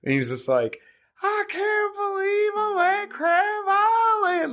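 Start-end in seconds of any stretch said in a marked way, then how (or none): 1.2-4.5 put-on very high, slow drunken caricature; hammed-up high country drunk wail; trashed Kentucky customer. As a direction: drag out every word in elated drunk disbelief. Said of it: "just completely trashed".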